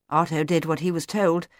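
ordinary delivery

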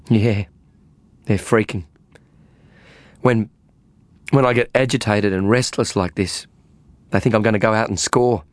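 a frazzled woman blurting out her worries; agitated woman tripping over her own words; agitated young woman. Acted. Faye voiced by narrator.

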